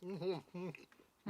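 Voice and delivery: humming through a mouthful of food; mm-hmm around a bite of food; mouth full. Real, not acted. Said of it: "forgive me for talking with my mouth full".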